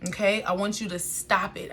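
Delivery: usual speaking voice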